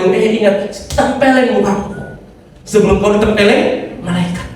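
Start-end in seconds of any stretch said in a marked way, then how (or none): none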